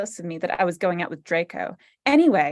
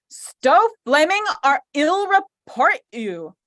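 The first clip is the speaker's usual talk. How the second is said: mock threat; 6 semitones higher, 4 dB louder than usual. Mock angry author's note.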